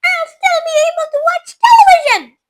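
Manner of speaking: high, loud squeaky shout; tiny defiant shout. A narrator's reading. Shrunken boy shouts defiantly at his parents. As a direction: squeak it in triumph.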